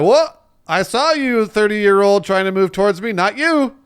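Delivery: high, loud indignant wizard voice; mock Ollivander. Ollivander scolding the thirty-year-old, high and loud. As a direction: act scandalized, up high. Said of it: "And I'd be like".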